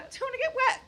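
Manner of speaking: put-on high whiny child voice; little kid refusing the shower, high whine. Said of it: "And they".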